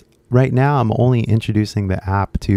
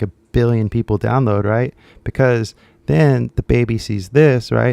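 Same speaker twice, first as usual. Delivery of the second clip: normal talking voice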